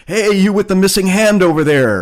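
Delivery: high, loud call to someone; mock holler from a distance. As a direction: shout it across to a stranger. Imitates calling out to someone nearby.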